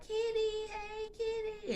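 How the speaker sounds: put-on high excited child voice calling Kitty; excited kids calling. Kids greeting their neighbor, high excited child calling. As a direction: mimic little kids greeting her happily. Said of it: "They used to always be like".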